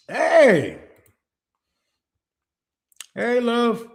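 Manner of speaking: ordinary talk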